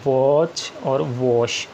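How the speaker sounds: "वॉच और वॉश" said very slowly; धीमे उच्चारण; slow demonstration. Shown as a demonstration. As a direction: draw out "वॉच" and "वॉश" for students. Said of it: "यहाँ पे बता देता हूँ".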